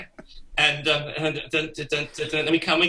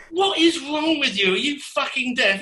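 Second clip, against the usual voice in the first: exasperated; 11 semitones higher than usual. Retold or imitated. Band exasperated at drummer.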